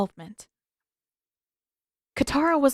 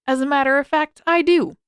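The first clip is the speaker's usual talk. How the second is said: higher, louder, nose in the air; haughty; 6 semitones higher, 6 dB louder than usual. Acted. Sokka covering his lie to Zuko, haughty and loud. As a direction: puff up and declare it proudly. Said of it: "Sokka stuck his nose up in the air".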